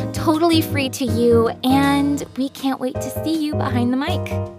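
ordinary talk, nothing marked

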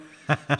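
short loud laugh; quick burst of amused laughter. Real, not acted. Laughs at retold Jedi jibe.

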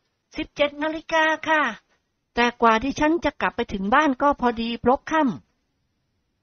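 put-on high, soft, polite girl voice; sweet polite young lady; young woman voice. Model answers time. (เสียงหญิงสาวสุภาพอ่อนหวาน)

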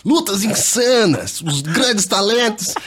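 high, slow hype-man delivery; fight announcer voice. Retold or imitated. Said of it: "mas ele deve ter falado".

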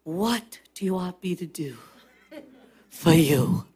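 low, soft, unhurried question; prophet's question. Acted. Prophet asking the widow her need, low and soft. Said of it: "Just like the prophet said to the widow".

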